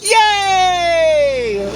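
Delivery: a shouted, hammed-up yay; mock cheer. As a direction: cheer it up, sarcastically. Mock cheer for Pride Month.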